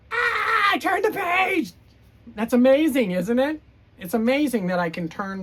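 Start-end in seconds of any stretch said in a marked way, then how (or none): 0.1-1.7 high, loud panicked Grover cry; panicked shout. Acted. Reading to kids, Grover screams that the page got turned. 2.4-3.6 excited amazement. Acted. Excited Grover.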